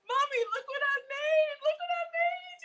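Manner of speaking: put-on high, kid-like "Mommy, look"; little kid showing Mommy; proud kid. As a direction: show off your art project to Mommy. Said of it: "like a kid at Sunday school".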